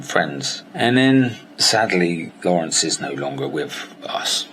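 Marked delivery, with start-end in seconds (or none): 1.6-4.5 slow, whispered grief; real grief for a lost friend, whispered; grieving. Real, not acted. Grieving his late mentor.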